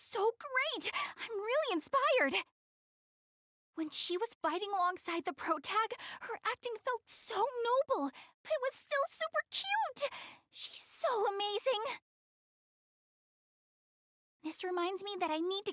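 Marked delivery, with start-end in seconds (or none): 0.0-12.0 higher, climbing excitement; breathless fan rave building higher; gushing fangirl. Acted. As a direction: gush about the new character's voice actress. Fangirling over a voice actress.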